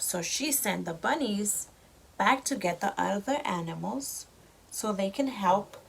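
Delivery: ordinary delivery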